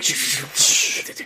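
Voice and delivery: repeated hissed hushing noises; urgent shushing. Retold or imitated. Doctor hushing companion about being from space, urgent shushes. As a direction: hush someone who is saying too much.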